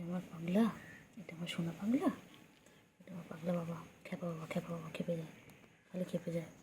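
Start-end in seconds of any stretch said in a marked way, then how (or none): none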